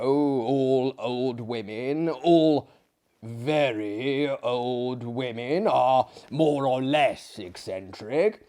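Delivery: slow, confiding explanation; knowing drawl. Acted. Maillard explaining old women to the guest, slow knowing. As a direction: stretch it out as common wisdom.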